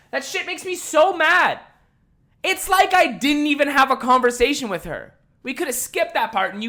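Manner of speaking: high, loud, slowed fury; fuming. Real, not acted. Recalling the customer who ignored him, fuming loud and high. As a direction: let the anger boil over. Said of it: "But I was fucking angry".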